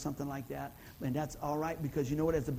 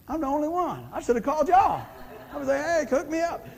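loud, high, joking appeal; joking call-out; 8 semitones higher, 7 dB louder than usual. Real, not acted. Pastor jokes to congregation about no money, loud playful plea.

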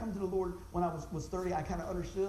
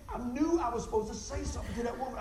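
ordinary talk

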